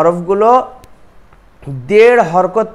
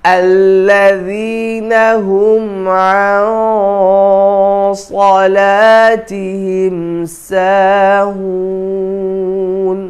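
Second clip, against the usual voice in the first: slow, melodic tilawat chanting; Quran recitation; much slower than usual. Shown as a demonstration. Teacher recites the whole surah slowly for students.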